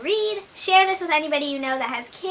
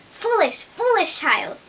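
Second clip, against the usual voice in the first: high distressed mother pig; 8 semitones higher, 4 dB louder, slower than usual. A narrator's reading. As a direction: scold in dismay, voice up high. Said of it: "looking quite distressed".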